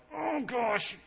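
high, squeezed, agonized mock struggle; hammed-up strain of handing it over unwillingly; strained reluctance. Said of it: "on the inside, there's this war".

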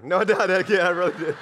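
real laugh breaking through the words. Real, not acted. Preacher admitting the joke to his congregation, laughing. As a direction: chuckle through a joking take-back. Joking about spanking his son.

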